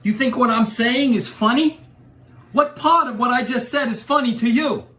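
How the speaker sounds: menacing rising tough guy rant; Joe Pesci impression. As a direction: Joe Pesci gangster voice, getting angry. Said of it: "Joe Pesci".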